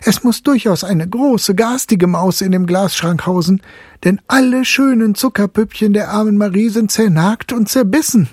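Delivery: high, indignant mother voice; fretful mother voice; upset mother. A narrator's reading. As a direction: complain about the nasty mouse. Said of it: "rief die Medizinalrätin".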